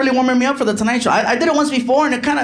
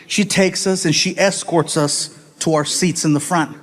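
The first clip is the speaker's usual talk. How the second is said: ordinary talk, nothing marked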